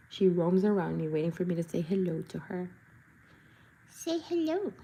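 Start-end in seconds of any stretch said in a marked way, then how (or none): 3.9-4.7 pet talk. Real, not acted. To dog.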